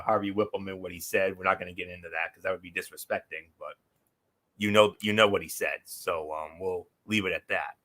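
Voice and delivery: ordinary delivery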